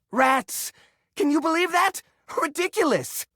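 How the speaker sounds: high, outraged scoff; indignant disbelief. Acted. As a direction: scoff at the idea. Outraged at accusation.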